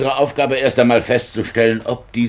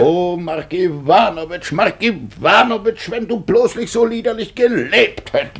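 high, wailing lament for the dead man; high keening over a wasted life; mock lament; 9 semitones higher, 3 dB louder than usual. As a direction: cry out in theatrical grief.

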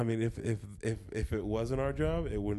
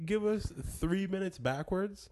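pitched-up voice addressing listeners who skipped; mock telling listeners; 10 semitones higher than usual. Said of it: "where I'm telling them".

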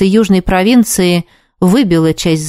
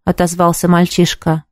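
ordinary delivery, nothing marked

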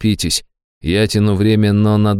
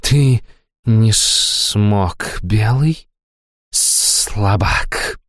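nightmare spirit; much slower than usual. A narrator's reading. Демоница презрительно дразнит Грайбая.

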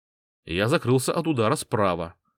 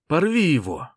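high, loud fighter's shout; 5 semitones higher, 5 dB louder than usual. Acted. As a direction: bark it at the fighter. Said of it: "крикнул он".

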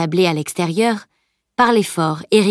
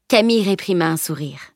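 ordinary delivery

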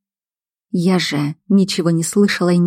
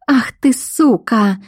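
higher, drawn-out outburst; angry cry; 5 semitones higher, slower than usual. A narrator's reading. Woman strikes the captive, mildly shouted fury. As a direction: snarl the insult slowly, without full volume. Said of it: "закричала она и ударила по лицу".

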